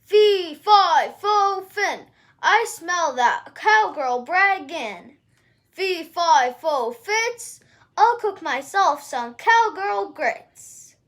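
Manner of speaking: loud, slow booming chant; thundering giant. Acted. Giant hunting the cowgirl, thundering chant. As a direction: bellow it like a giant on the hunt. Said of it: "the giant's voice thundered".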